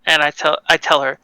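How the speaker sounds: normal talking voice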